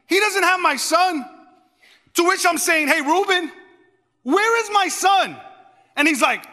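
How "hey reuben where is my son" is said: retold alarm, voice pitched up; alarmed questioning. Father confronting his brother about his lost son, alarmed.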